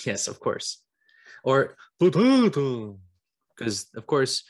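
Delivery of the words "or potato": "potato" stretched out slowly and louder, in an odd put-on voice; mock vampire pronunciation. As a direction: drag out the word "potato" in a hammed-up voice. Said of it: "they would say the potato famine".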